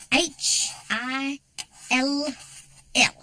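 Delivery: slow, drawn-out teacher announcement; slow spelling out. Acted. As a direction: spell the name out slowly for the class. Spelling her name slowly to class.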